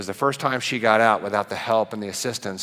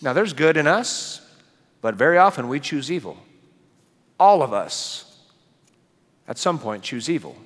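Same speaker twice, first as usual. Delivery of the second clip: raised, rising preacher's voice building to a peak; preaching at full force; 6 semitones higher, 4 dB louder than usual. Acted. Pastor preaching God's image to the congregation, full force.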